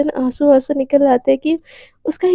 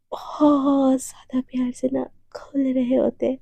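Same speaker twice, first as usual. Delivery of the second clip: whispered narration; 4 dB quieter than usual. A narrator's reading. Narrator softly whispering the tender kiss scene to listeners, hushed.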